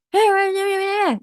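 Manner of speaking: sharp high shouted "Hei, weh"; harsh tone. Shown as a demonstration. Teacher demonstrating a harsh rude tone to students. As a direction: bark it out rudely to show a harsh tone. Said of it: "berbicara dengan nada kasar".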